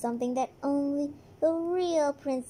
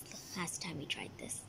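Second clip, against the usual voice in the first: whispering; 9 semitones lower, 13 dB quieter than usual. Acted. Unvoiced whisper.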